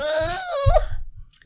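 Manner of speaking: high-pitched creeped-out squeal; horrified wail. Real, not acted. Listener hears the power went out, horrified wail. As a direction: let out a high wail of dread. Reacting in horror to the story.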